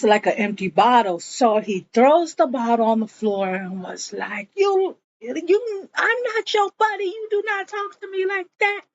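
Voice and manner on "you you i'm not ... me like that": high, fast imitated fury; mock angry yell. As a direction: act out his tantrum, pitched up. He throws a bottle, yells.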